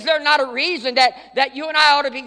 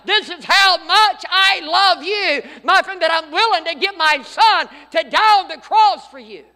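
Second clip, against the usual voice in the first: 1 voice of God; 5 semitones higher, 6 dB louder than usual. Acted. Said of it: "God was saying to the world".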